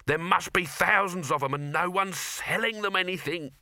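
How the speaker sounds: high-pitched trader's lament; Cut Me Own Throat. A narrator's reading. Trader seeing thousands unsold to, high plaintive voice. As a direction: bemoan all those customers going to waste. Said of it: "Cut Me Own Throat sighed".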